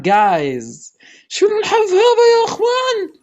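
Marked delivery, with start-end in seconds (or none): 1.3-3.1 high-pitched amazed cry; stunned. Real, not acted. Player asking viewers about his luck, high amazed squeal.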